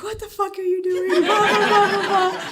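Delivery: high, mock-indignant outburst building into loud blahs; imitated outraged protest. Husband sees her running with the kitty, mock outrage.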